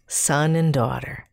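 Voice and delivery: plain reading voice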